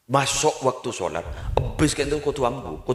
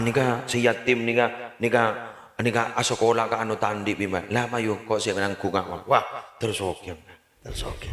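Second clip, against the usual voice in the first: normal talking voice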